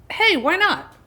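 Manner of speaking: breezy why not. Retold or imitated. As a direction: shrug it off like it's no big deal. Retelling her own casual thought.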